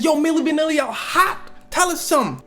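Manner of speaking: high, excited TV host voice; hyped-up host. Acted. MTV host welcoming Milli Vanilli on air, hyped-up. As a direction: pitch it way up to hype the guests.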